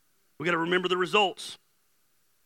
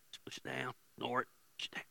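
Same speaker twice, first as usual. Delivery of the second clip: near-whispered, low and soft; hushed self-talk; 5 semitones lower, 13 dB quieter, faster than usual. Pretend. Preacher acting out people stuffing anger down, hushed. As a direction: mutter it under your breath, pushing it down.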